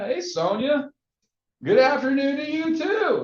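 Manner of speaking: high, delighted sing-song reply; friendly greeting back. Retold or imitated. Prospect warming to a cold caller, delighted. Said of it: "they're like".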